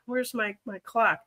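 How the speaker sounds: usual speaking voice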